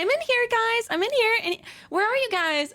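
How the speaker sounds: high, searching call to friends. Retold or imitated. Acted text asking where friends are.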